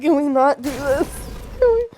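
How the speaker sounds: mock pleading. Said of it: "I'm sitting there like".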